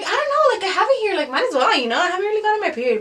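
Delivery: high-pitched retold excuse; imitating an uncertain, defensive reply. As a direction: explain yourself in a high, rising voice. Acted defensive answer to brother.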